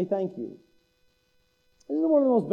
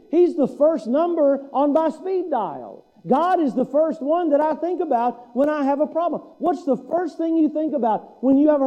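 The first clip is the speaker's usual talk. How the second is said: preaching at full force; 5 semitones higher than usual. Acted. Preaching builds on distractions.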